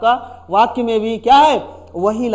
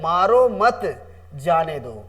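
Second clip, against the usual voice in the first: "मारो मत", pause, then a lower "जाने दो"; pause after मत; 3 semitones lower, slower than usual. Shown as a demonstration. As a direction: break after मत, let जाने दो fall. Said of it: "मारो मत" के बाद कॉमा लगा". ("मारो मत" के बाद रुककर "जाने दो")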